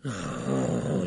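moan. Acted. Sirius curled on the ground before the dementors, moaning. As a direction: let the moan sink low. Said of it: "gémissait-il".

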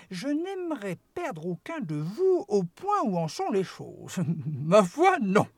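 a showman's welcome, sweet and slightly mocking; gleeful chocolatier greeting his guests; eccentric host. Wonka welcomes Charlie and leads.

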